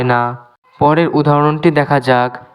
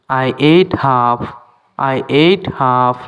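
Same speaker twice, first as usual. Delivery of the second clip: slow, clear English for learners; careful dictation pace; slow teaching demo; much slower than usual. Shown as a demonstration. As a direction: spell each word out slowly for students. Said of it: "teacher repeats example slowly".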